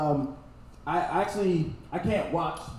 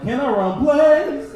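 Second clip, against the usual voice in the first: louder, slow, higher incredulous echo; mock disbelief; 9 semitones higher, 8 dB louder, slower than usual. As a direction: repeat the question in stunned disbelief. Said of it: "I was like".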